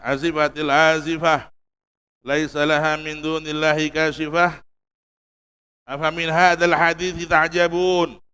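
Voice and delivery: measured, slowed Arabic recitation of verses; Quran recitation. Acted. Preacher recites Quran verses to the congregation, slow and solemn. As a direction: recite the ayat slowly and solemnly.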